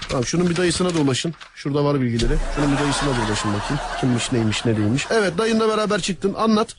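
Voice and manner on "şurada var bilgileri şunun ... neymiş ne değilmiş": laughing while talking; can't keep a straight face. Real, not acted. Interrogator breaks into laughter.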